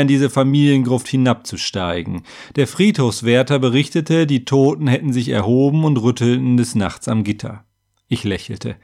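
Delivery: ordinary delivery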